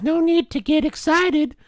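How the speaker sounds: high, slow, placating imitation; soft-voiced customer. Customer telling the clerk to settle down, mock soft voice. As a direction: mimic him calming you down in a drawn-out high voice. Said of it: "he has a soft voice".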